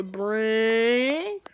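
ordinary talk

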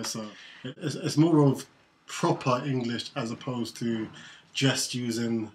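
ordinary talk

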